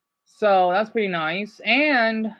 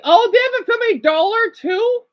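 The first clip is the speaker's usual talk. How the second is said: surprised; 12 semitones higher, 5 dB louder than usual. Real, not acted. Surprised exclaim at roadside sight.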